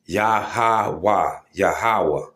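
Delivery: "Yahweh" said carefully as "Ya-ha-wa"; correct pronunciation. Shown as a demonstration. Teaching viewers the actual pronunciation of "Yahweh", carefully spoken. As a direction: spell out "Yahweh" syllable by syllable, slow and deliberate. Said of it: "if you want the actual pronunciation".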